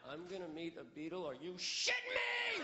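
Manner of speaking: inner excitement bursting into a shriek; inner freakout. Retold or imitated. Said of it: "on the inside".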